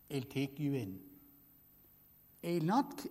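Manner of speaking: ordinary talk, nothing marked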